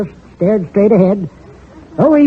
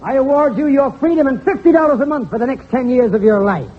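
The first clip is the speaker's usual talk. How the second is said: high pompous judge; 6 semitones higher than usual. Retold or imitated. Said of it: "The judge says".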